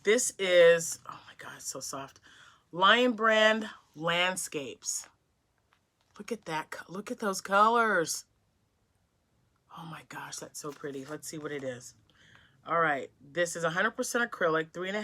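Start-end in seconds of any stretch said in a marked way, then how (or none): 1.1-2.2 breathy hushed whisper over soft yarn; awed whisper. Real, not acted. A crafter unboxing yarn from a friend, whispering in awe. 9.8-11.9 hushed and much softer, almost to herself; soft awed whisper. Real, not acted. Admiring pretty yarn colours alone, in a soft awed whisper.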